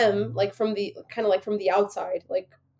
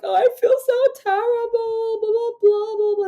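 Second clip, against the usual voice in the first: high whiny mock sob voice, higher; mocking mother's fake crying; 14 semitones higher, 4 dB louder than usual. Daughter mocking her mother's crying fit, high whiny voice. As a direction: imitate a mother wailing self-pity, pitched way up. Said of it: "start crying and being like".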